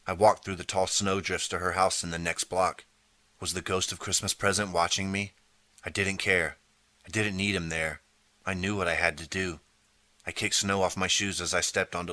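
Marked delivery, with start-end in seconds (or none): none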